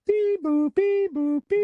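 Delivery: high electronic beep boop; robot beeping. Pretend. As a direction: chirp like a machine beeping. Imitating device beeping.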